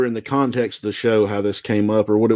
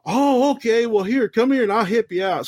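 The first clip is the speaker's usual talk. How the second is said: much higher, motherly stranger voice; kindly lady; 9 semitones higher than usual. Retold or imitated. Store lady offering to bandage the wrestler, much higher and kindly. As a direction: raise the pitch and soothe like a helpful lady. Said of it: "He goes".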